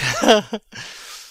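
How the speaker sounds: hearty burst of laughter; a bright, delighted laugh. Acted. Hamlet greets old actor friend.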